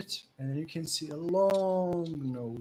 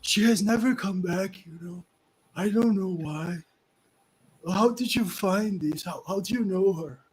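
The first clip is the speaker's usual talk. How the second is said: put-on higher, louder old man pleading; longing old man; 5 semitones higher, 6 dB louder than usual. Old man asking the party about his lost Zebilna, longing. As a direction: ask with an old man's longing.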